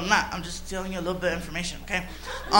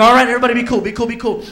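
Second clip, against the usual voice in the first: loud urgent calming; urgent hush; 12 dB louder, much faster than usual. Retold or imitated. Driver loudly urging his friends to stay cool as the cop comes. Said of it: "I go".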